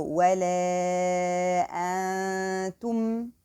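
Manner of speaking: wala antum stretched very slowly; drawn-out tajweed recitation. Shown as a demonstration. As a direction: chant wala antum slowly with nasal noon. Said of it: "It should be antum".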